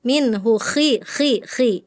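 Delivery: KHI repeated slowly with raspy kh; scraped kho. Shown as a demonstration. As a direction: lean on the friction in each KHI.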